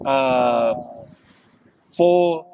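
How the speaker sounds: usual speaking voice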